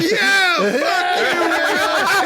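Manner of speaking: high, gleeful mob jeer; imitated crowd roar. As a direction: pile on like a roaring crowd. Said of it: "tremendous roar from the crowd".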